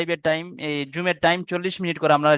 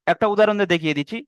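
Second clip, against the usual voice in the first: ordinary talk, nothing marked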